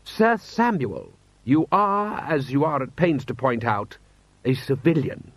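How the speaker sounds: high, slow, lordly rebuke; haughty lord. Acted. Lord Rust reminds the commander he is civilian, haughty. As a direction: start high and drawn out, then explain loftily. Rust pulls rank on Vimes.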